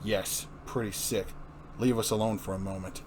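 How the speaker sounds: weary police commissioner; grim commissioner. Acted. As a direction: say it heavy and grim. Gordon voiced low and slow.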